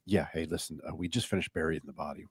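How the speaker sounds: low hushed mock-criminal voice, deadpan; mock serious quiet. Joking about a fake prank phone call, low hush. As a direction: murmur it low like a guilty confession. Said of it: "Just go".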